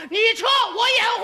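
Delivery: high, loud, fast heroic line; mock heroic shout. Husband tells wife to flee, mock heroic shout. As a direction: play the war hero covering retreat.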